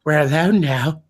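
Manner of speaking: leer like a lecherous bird; lightly voiced pervy bird puppet; creepy bird. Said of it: "Poe's just like".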